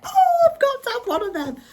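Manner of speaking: very high, gushing "I've got to have one"; excited squeal. Retold or imitated. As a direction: squeal it like a shopper who can't resist. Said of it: "I saw it and I was like".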